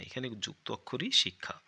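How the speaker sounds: usual speaking voice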